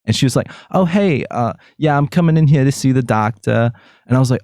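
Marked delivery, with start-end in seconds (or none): 0.7-3.7 higher-pitched imitation of a Jersey girl; thick Jersey accent. Patient checking in at doctor's office, thick Jersey accent. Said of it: "like, a really thick Jersey accent".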